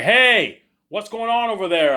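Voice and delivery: loud, high call across the classroom; teacher calling out. Retold or imitated. Teacher catches kid hacking at the back, loud call. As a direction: shout it at the back row.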